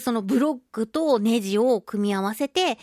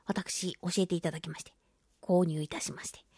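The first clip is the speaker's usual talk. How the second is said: hushed, sheepish confession, barely voiced; sheepish whisper; 4 semitones lower, 9 dB quieter than usual. Real, not acted. Aunt confessing she bought the gift, in a sheepish whisper. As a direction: whisper it like owning up to something a bit embarrassing. Humbly admits buying the gift.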